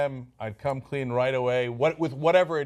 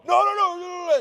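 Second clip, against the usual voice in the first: hammed-up panicky denial, high and loud; defensive; 6 dB louder than usual. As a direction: act out a loud, high-pitched defensive protest. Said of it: "get defensive and go".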